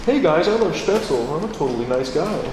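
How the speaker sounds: high fake-friendly voice of a suck-up; mock a streamer pandering to Germans; mocking a panderer. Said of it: "trying to pander and just ingratiate himself".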